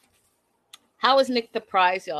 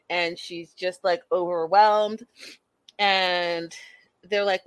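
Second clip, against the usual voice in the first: ordinary delivery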